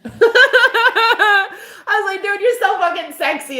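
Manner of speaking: high giggly swoon over him; giggling swoon. Retold or imitated. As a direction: laugh and gush, way up high. Swooning reaction retold.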